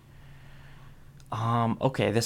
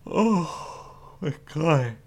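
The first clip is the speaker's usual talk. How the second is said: yawning; 7 semitones higher, 6 dB louder, much slower than usual. Real, not acted. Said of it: "I didn't get any sleep last night".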